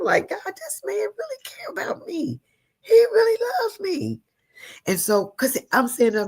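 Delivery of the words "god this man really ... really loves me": high-pitched, moved exclamation. Retold or imitated. Woman recalling her husband's care, moved. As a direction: retell it with swelling feeling.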